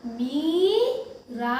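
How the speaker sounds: मीरा with the ई held long; slow मी-रा, rising; stretched मीरा. Shown as a demonstration. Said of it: "बड़ी की मात्रा है ना".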